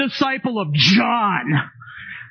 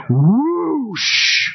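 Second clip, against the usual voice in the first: loud rushing water whoosh; 3 semitones higher, 4 dB louder than usual. Pretend. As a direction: burst out like a flood. Dam bursting imitation.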